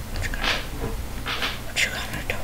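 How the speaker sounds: hushed mocking whisper; whispered taunt. Whispering the taunt at the squid from the boat.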